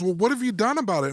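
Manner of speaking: high, pointed question; retold challenge. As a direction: act out pressing a spouse.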